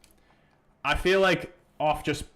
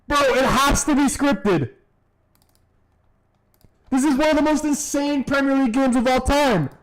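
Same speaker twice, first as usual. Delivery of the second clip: loud, high, stunned; excited shouting; 9 semitones higher, 6 dB louder, slower than usual. Real, not acted. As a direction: yell it in disbelief. Still reacting to late goal.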